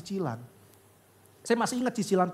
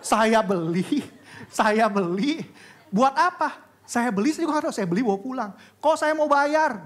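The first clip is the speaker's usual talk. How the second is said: high, louder emphatic telling; excited retelling; 6 semitones higher, 5 dB louder than usual. Acted. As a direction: let the disbelief ring out. (penuh semangat dan heran, suara tinggi)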